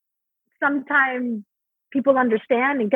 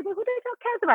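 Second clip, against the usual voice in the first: much higher and softer, timid cutesy "who the hell cares about it?"; cutified line; 12 semitones higher, 7 dB quieter, much faster than usual. Shown as a demonstration. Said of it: "before when I would say".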